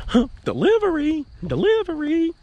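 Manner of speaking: high singsong delivery call; mock pizza delivery call. Pretending to be the pizza man, high singsong call. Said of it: "like I'm the pizza man".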